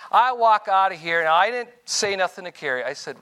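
ordinary delivery

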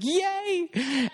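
happy cheer. Retold or imitated. Rescuing the girls, celebrating.

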